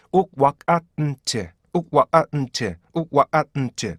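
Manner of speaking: 욱와아은치 said slowly beat by beat; slow syllable drill. Shown as a demonstration. Teacher drills the stop-sound beats slowly. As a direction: spell want out in beats. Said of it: "이렇게 읽어야지".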